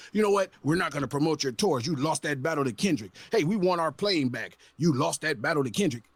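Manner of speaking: a mocking record exec delivering bad news; mock label exec. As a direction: talk down like a smug suit cutting a deal.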